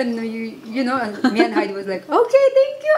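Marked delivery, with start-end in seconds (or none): 2.1-2.9 high, bright excited squeal of thanks; excited thank-you. Retold or imitated. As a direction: squeal it out, thrilled to be invited. Girls thank Lawrence for invite.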